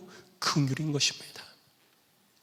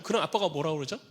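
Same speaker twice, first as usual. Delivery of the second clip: ordinary delivery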